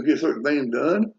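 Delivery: usual speaking voice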